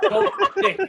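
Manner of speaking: sudden high yelp of his name; high pleading call. Retold or imitated. Coach stopping Nick from losing it, high pleading call. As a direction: cry out his name to stop him.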